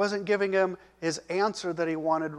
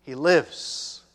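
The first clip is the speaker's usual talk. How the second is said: loud, triumphant declaration; proclaiming loud; 7 dB louder than usual. Shown as a demonstration. Preacher proclaiming the son lives, loud and triumphant. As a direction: announce it with joy. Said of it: "As I'm speaking".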